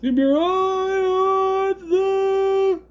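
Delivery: very high goofy voice, words stretched right out; Dan Aykroyd. Acted. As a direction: push the pitch way up and drag every word. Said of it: "a goofy voice like".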